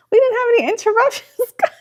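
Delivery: high, squealing delight; overjoyed. Real, not acted. Host thrilled the stream had no interruptions. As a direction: gush with real relief and joy. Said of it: "I'm gonna cry".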